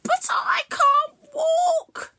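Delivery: moaning. Acted. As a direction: wail it out in misery. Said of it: "moaned Matcha".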